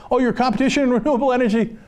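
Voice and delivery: fast, breezy imitation of a governor; mock governor. Host mimicking governor reassuring donors, quick and breezy. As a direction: toss it off like a cozy favor. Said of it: "John Kasich turns around and says".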